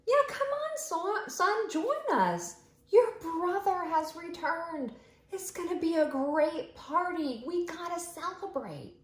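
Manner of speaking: high, bright coaxing dad voice; cheerful father. Acted. Father urging the older son to join, high and cheerful. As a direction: coax the sulky son into the fun.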